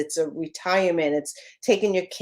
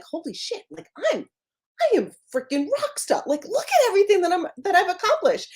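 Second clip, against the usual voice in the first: much higher, amazed and delighted with herself; fast, high, giddy self-praise; thrilled; 11 semitones higher, faster than usual. Retold or imitated. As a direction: gush about being a rock star. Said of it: "I'm like".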